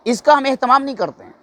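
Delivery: ordinary talk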